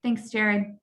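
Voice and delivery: ordinary talk, nothing marked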